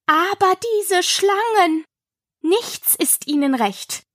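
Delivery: lightly voiced lamenting bird; aggrieved pigeon. A narrator's reading. Pigeon complains about the snakes, aggrieved.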